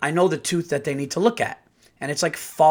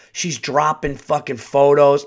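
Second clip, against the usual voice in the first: normal talking voice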